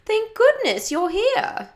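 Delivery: high, slow, loud mock-English gushing; fake English accent. A narrator's reading. Birthday girl welcomes her best friend, fake English accent. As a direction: gush in a put-on English accent. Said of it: "said in a fake English accent".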